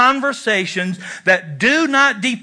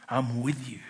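much lower and softer, almost whispered; quiet tender; 8 semitones lower, 13 dB quieter than usual. Shown as a demonstration. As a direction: murmur it close and sincere. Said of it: "there's a difference between saying".

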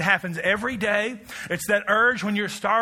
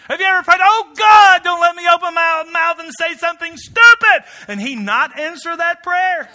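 higher, much louder, crying the prayer out; preaching shout; 12 semitones higher, 13 dB louder than usual. Acted. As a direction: bellow it to the congregation.

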